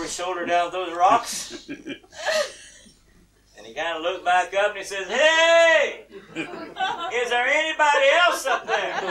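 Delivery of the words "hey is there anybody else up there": high, loud, drawn-out call; hollering for help. Acted. Man rejecting God's advice, hollering up the cliff. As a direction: yell upward for another rescuer.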